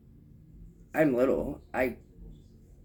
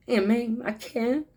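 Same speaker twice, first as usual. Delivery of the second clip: put-on high, whiny little kid voice, louder; little niece; 13 semitones higher, 5 dB louder than usual. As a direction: play a small child pleading with her aunt. Niece as small child whining.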